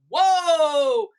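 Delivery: loud, high, drawn-out exclamation; retold astonishment. Seller watching the wife storm off, hammed-up loud astonishment. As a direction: react big, hammed-up shock. Retold reaction to being yelled at.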